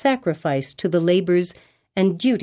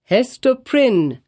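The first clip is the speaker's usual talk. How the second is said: stern; 6 semitones higher, 3 dB louder, much slower than usual. A narrator's reading. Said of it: "fixing his naturally stern regard".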